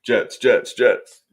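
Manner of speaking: loud, punchy team chant; altered cheering-fan voice; put-on hype voice. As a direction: chant it in short, forceful bursts. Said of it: "I was doing my voice".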